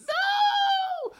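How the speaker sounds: high, loud playful no; mock horrified shout. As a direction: cry out in fake horror. Mock horror at corporate.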